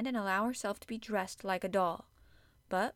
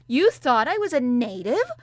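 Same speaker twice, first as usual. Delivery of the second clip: loud, higher furious tirade; enraged child yelling; raging; 5 semitones higher, 9 dB louder than usual. A narrator's reading. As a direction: lash out in humiliation. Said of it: "did not even try to control her rage".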